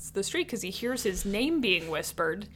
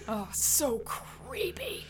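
whispering; 3 semitones higher, 8 dB quieter, much slower than usual. Pretend. Reacting to creepy whispered name.

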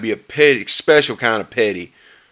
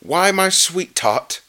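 sugary, drawn-out, raised pitch; Grinch playing Santa; 7 semitones higher, much slower than usual. A narrator's reading. Grinch fibbing to little Who, fake-sweet Santa. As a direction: coo it slowly to fool a child. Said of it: "he faked".

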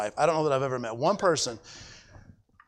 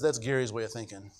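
lower, softer imitation of a gentle put-down; Renee; 7 semitones lower, 8 dB quieter than usual. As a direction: quietly imitate her pet saying. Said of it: "She says it just like that".

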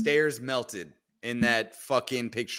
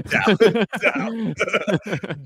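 higher, louder silly doubt; mock doubt; 7 semitones higher, 6 dB louder than usual. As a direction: ham up the word doubt. Repeating meme word.

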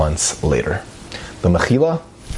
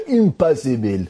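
high, hammed-up Israeli accent; 7 semitones higher than usual. Storyteller mimicking Israelis' verdict of impossible, high Israeli accent. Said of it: "they say in Israel".

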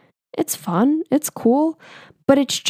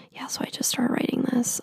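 soft unvoiced whisper; whispered aside; 3 semitones higher, 9 dB quieter than usual. Nurse quietly introducing his poem, whispered. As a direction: murmur it under your breath.

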